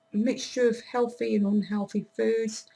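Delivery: normal talking voice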